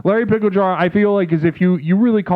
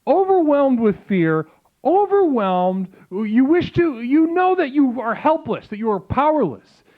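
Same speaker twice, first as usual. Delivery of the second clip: slow, higher, grandly dramatic preaching; mock solemn sermon; 7 semitones higher, slower than usual. Streamer preaching mock doom to chat, slow and grand. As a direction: drag out the doom like a prophet.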